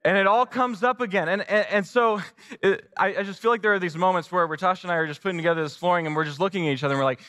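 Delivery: normal talking voice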